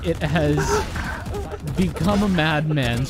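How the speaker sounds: loud, drawn-out amazed exclamation; amazed reaction. Real, not acted. Stunned reaction to a game play.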